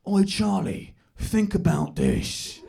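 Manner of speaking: slow, weighty dad impersonation; mock-philosophical East London dad; would-be profound dad. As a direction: say it like a deep observation about life. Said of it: "things that he thinks are deep observations".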